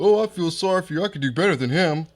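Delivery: hammed-up sweet talk to someone's girlfriend; mock sympathy. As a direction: put on a rival's pitying voice. Rival sweet-talking the girlfriend.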